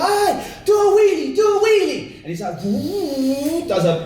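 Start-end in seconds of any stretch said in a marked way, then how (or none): 0.0-2.2 high, eager shout; excited kids shouting. Acted. Excited kids calling to the biker. 2.6-3.7 high, drawn-out whoop; long wooo of a showing-off rider; imitated biker whoop. Imitating biker doing a wheelie.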